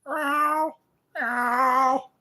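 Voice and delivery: high, odd cat yowls; imitated cat cry; brain-damaged cat meows. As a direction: meow like an addled cat. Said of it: "Sounds like a brain damaged cat".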